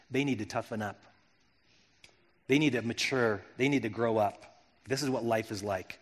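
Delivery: understated imitation of an unmoved man; dismissive reply. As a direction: brush off the complaint quietly. Retold curt reply to criticism.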